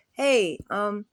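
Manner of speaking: put-on loud pointed question for the old man; says it out loud. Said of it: "she says out loud".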